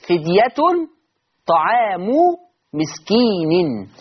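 فدية طعام مسكين drawn out slowly, word by word; مسكين stretched at the end; slow recitation. Shown as a demonstration. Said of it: "حفص هيعمل إيه؟".

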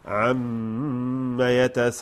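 slow chanted verse; Quran recitation. Shown as a demonstration. Teacher chanting the verse for students. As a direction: chant it with tajweed.

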